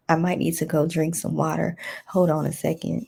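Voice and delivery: ordinary talk, nothing marked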